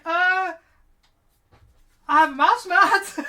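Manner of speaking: high, very loud flustered quote; hammed-up stunned shout, laughing at the end; imitated gobsmacked reaction. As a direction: blurt it loudly, breaking into laughter.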